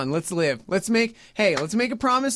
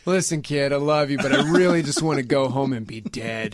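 low, deadpan imitation of a father; mock weary dad; 7 semitones lower than usual. Father telling his kid about dying, mock weary voice. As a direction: lower the voice into gloomy fatherly frankness.

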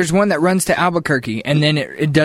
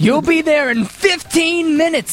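slow, high booming transit announcer; 8 semitones higher, slower than usual. Pretend. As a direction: announce the next stop over the speakers.

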